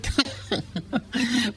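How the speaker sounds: bursts into a high laugh. Real, not acted. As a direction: laugh at the memory. Laughing at own story.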